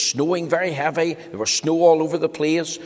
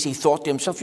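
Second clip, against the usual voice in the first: plain reading voice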